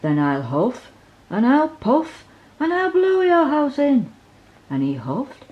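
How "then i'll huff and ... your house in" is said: louder and louder wolf threat, climbing high at the end; cross big bad wolf shouting. A narrator's reading. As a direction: build the huffing threat to a roar. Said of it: "he shouted".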